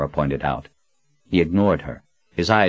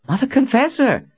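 much higher, loud cry of the title; surprised exclamation; 4 dB louder than usual. A narrator's reading. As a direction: blurt the name in delighted awe. Young man exclaims her title.

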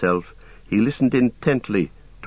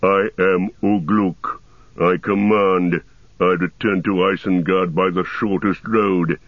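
Ugluk. A narrator's reading. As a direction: growl it as absolute command. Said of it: "said the growling voice".

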